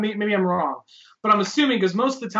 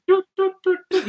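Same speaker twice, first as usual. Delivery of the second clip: imitated beeps; 11 semitones higher than usual.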